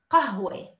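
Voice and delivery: teacher modelling the word to repeat; standard qahwe. Shown as a demonstration. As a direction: model قהوة clearly as qahwe. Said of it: "Repeat after me".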